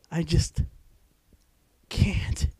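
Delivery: loud, strained through giggles; laughing while reading. Real, not acted. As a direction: fight the laughter to get words out.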